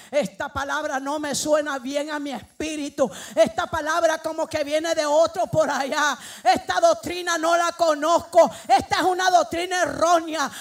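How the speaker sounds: loud, driving sermon delivery rising in pitch; full-force preaching. Real, not acted. Preacher denouncing believers who live on emotion, full-force preaching.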